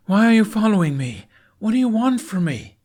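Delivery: loud, high, desperate yelling; frightened shout. Acted. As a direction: shout at the shadows, scared and defiant. Jack confronts unseen pursuer.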